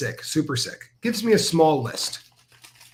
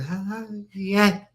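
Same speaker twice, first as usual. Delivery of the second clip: slow, higher garbled syllables; drawn-out mock nonsense; imitated short-circuit babble; 4 semitones higher, slower than usual. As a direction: mimic her brain glitching mid-sentence. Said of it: "She short-circuited because she was sick".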